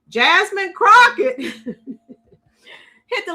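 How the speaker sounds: slow words broken up by laughter; cracking up mid-sentence; laughing. Real, not acted. Laughing at clip's claim.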